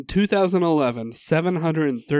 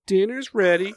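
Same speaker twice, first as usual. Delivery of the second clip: yelling across the room; 6 semitones higher than usual. Retold or imitated. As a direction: holler that dinner's ready. Imitating [name] dinner call.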